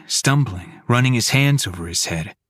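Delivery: plain reading voice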